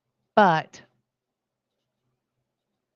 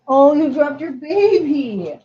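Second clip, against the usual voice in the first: higher, slow sing-song cooing; 7 semitones higher, 4 dB louder, slower than usual. Real, not acted. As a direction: coo at the dog about its toy. Cooing to dog about toy.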